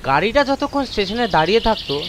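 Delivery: ordinary delivery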